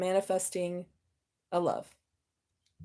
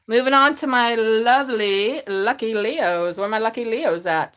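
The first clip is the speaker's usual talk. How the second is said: sing-song host call; 6 dB louder, slower than usual. Real, not acted. Announcing next sign.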